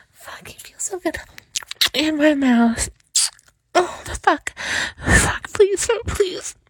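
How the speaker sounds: builds from a high whisper to loud high cries; rising climax. Acted. Reaching climax with her partner, building from whisper to loud cries. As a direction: climb from hushed to loud, pitch soaring.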